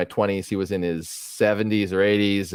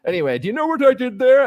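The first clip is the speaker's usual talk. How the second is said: much higher, put-on genteel old gentleman voice; imitating Dwayne Duvall; 12 semitones higher, 4 dB louder than usual. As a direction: introduce yourself like a courtly old magician, then probe. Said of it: "'Cause he kind of talked like this".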